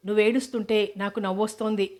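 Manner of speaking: ordinary delivery, nothing marked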